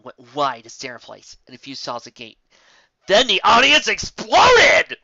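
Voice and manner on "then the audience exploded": loud, slow, drawn-out burst; big loud narrator outburst; exploding excitement. A narrator's reading. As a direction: let it blow up like a crowd. Crowd erupts.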